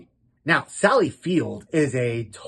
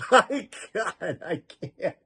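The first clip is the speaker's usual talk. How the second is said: laughing; 2 semitones higher, 5 dB quieter, slower than usual. Real, not acted. Laughing fit after the clip.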